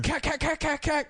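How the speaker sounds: high mock machine gun sound; mock gunfire. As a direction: imitate gunfire with cat. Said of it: "you know, he's like".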